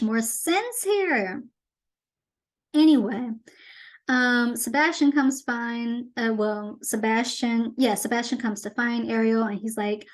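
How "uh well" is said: ordinary talk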